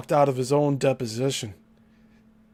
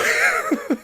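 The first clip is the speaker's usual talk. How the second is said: high burst of laughter; real laughing fit. Real, not acted. Laughing at his walking out of the deposition, real laughter. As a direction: crack up at your own impression.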